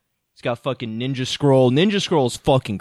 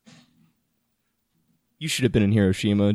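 ordinary delivery

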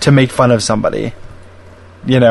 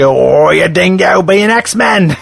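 bad, over-the-top Australian accent, drawn out and higher; mock Wolverine; 4 semitones higher, much slower than usual. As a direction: drag out a mock Australian growl. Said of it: "uses Australian, uh, uh, like, slang".

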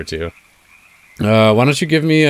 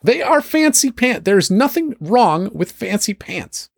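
very high, fussy character voice; a dandy defending his pants; prissy NPC; 12 semitones higher than usual. Acted.

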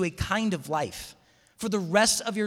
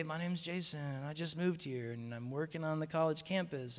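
weary rote introduction; 2 semitones lower, 10 dB quieter than usual. Retold or imitated. Newcomer introducing himself to yet another stranger, weary recital. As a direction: reel it off softly like reciting stats.

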